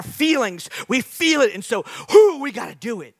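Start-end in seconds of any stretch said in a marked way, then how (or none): 1.5-2.4 excited whoo. Retold or imitated. Enacting impulsive feeling.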